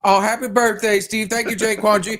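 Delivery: hammed-up, high-pitched birthday gratitude; gushing thanks. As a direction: gush as if handed a bouquet.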